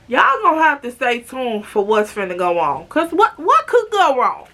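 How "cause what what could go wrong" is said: mock-innocent teasing. Teasing cliffhanger rhetorical question.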